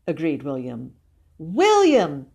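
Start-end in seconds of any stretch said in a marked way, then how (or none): 1.4-2.3 higher, louder insistent call. A narrator's reading. Sister calls the hidden boy once more, calling out. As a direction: call the name across a garden. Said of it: "The cry came from somewhere".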